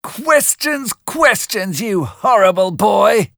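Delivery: hammed-up nagging woman; irritated sister. A narrator's reading. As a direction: Mrs Joe fed up with Pip's questions. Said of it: "said Missus Joe".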